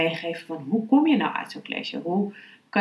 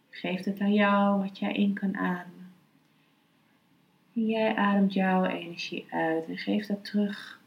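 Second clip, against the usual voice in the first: guided meditation; 3 dB quieter than usual. Acted. (rustige, zachte meditatiestem)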